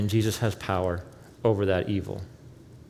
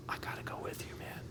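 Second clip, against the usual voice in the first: urgent whispered plea; 18 dB quieter, faster than usual. Acted. Healed man begging Jesus to take him along, whispered. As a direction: breathe it out, desperate to follow.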